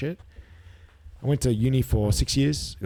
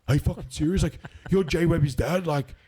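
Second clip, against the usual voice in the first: put-on high-pitched, amazed young fan; imitated excited recognition; starstruck disbelief; 7 semitones higher than usual. Starstruck son recognises the dad, acted.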